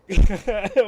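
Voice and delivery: loud, high laugh; burst of laughter. Real, not acted. Storyteller cracking up at their own tale, loud laughing. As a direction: crack up loudly.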